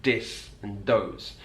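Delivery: "dis" with a hard D, slow demo. Shown as a demonstration. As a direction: say "dis" with a D for th, slowly. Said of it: "can be replaced with a D".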